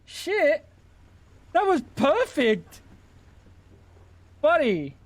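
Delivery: squeaky mock-British praise; shit British accent. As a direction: ham up a bad British accent. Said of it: "the shit British accent".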